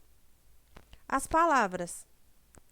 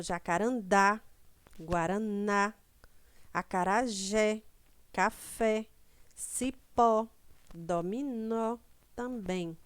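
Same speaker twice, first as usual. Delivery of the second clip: jacarandá, guaraná, acarajé, café, cipó, dominó with the final syllable hit hard; teacher stressing final syllables; stress on last syllable; slower than usual. Shown as a demonstration. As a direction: punch the last syllable of each word. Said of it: "última sílaba pronunciada com mais força".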